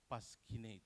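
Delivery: paskinate with p for f and a k; students' mispronunciation. Retold or imitated. Presenter imitates students' error, hard k. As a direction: say paskinate, pas-ki-nate. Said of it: "any student pronounce it".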